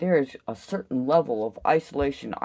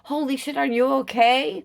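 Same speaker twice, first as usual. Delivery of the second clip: mock worried bystander; 7 semitones higher than usual. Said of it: "someone's like".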